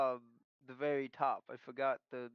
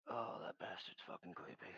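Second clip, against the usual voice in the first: hushed, whispered aside; muttering under breath; 7 dB quieter than usual. Acted. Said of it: "mutter underneath my breath".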